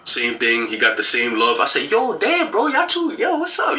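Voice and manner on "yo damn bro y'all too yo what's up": very high, mock-excited teasing voice; teasing his brother at the ceremony, pitched way up; clowning around. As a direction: clown on your friends in a squeaky hype voice. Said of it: "just kinda clowning with them".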